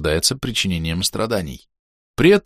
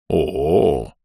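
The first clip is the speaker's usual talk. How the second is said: much lower long pensive ooh; thoughtful drawn-out oh; 5 semitones lower than usual. Acted. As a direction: draw it out, thinking. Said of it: "протянул задумчиво Аньрул".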